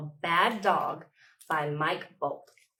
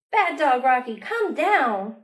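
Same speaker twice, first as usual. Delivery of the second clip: scolding call; 6 semitones higher, 7 dB louder, slower than usual. Acted. Child scolding dog up high.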